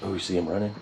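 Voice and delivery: ordinary talk, nothing marked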